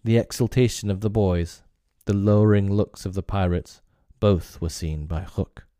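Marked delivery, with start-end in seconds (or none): none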